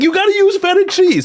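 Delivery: very high, loud retold demand; exasperated mock yell. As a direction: yell it hammed-up at a clueless spouse. Acted shouted demand to wife.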